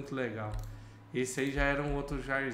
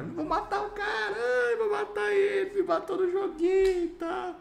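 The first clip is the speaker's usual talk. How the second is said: much higher, loud, whining half-laughing sob; mock crying whine; 5 dB louder than usual. Gamer retelling how he whined after being killed, mock crying. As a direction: whine like a wronged kid on the verge of tears. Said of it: "E eu quase chorando".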